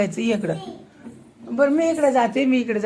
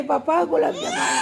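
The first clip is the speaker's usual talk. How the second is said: imitating crying child; 5 semitones higher, 4 dB quieter than usual. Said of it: "पप्पाला सांगते".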